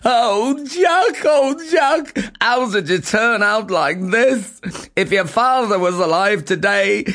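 high, wailing lament, lightly played; mock despair. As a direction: wail over the lazy son. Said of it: "breaking down in tears of despair".

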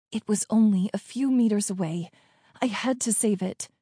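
plain reading voice

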